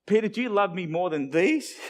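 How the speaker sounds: incredulous, playful question ending high; silly question. Acted. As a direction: ask it as if it sounds silly. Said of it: "sort of sounds silly".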